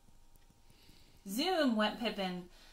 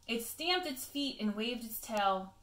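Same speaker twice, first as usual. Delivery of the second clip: ordinary delivery, nothing marked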